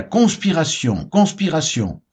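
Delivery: mild narrated exclamation, pitched well up; narrated outcry. A narrator's reading. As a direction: read it as a restrained, high cry of alarm. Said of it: "et cria".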